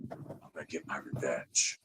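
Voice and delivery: low, soft mock-villain promise; low playful threat, kidding; mock sinister. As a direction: murmur the threat like a cartoon villain. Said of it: "I'm kidding".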